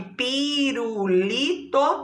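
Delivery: "pirulito" stretched into pi, ru, li, to; syllable by syllable. Shown as a demonstration. Teacher confirming four syllables, each one separated. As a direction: linger on each beat of "pirulito". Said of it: "tem quatro sílabas".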